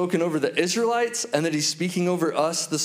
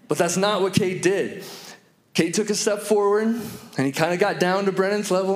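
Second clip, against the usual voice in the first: ordinary talk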